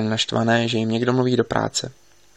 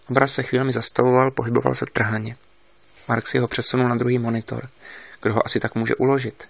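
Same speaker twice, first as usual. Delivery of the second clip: usual speaking voice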